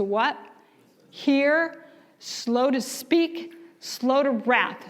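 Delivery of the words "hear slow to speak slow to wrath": "Hear, slow to speak" stretched out slowly, each phrase lifted; slow scripture recital. Real, not acted. Preacher quoting James to the church, slow and deliberate. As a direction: savour each word of the verse. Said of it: "reciting scripture slowly".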